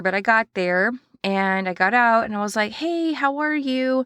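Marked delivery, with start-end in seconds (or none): 2.7-4.1 bright, high, drawn-out hello; cheery greeting. Retold or imitated. Boss greeting the new assistant at the gate, high and slow. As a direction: greet her sunnily, stretching the hey.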